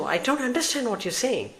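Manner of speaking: high, far-off robotic confusion; mock assistant voice. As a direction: squeak it like a baffled phone assistant. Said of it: "I can't do that and all that".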